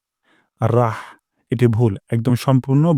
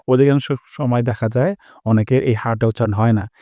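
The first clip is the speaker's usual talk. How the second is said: ordinary talk, nothing marked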